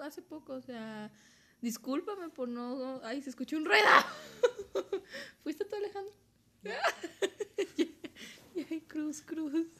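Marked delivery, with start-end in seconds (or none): 3.0-3.8 a sudden, louder, higher startled cry; startled fright. Real, not acted. Hearing a strange noise mid-podcast, startled cry. Hears a noise while recording. 3.9-5.4 a high, loud nervous burst of laughter. Real, not acted. After the noise scares her, high nervous laughter. 5.4-9.7 higher, jittery half-laughing plea; nervous laughing. Real, not acted. Asking a friend if he made the noise, nervous laughter.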